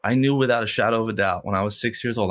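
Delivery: ordinary talk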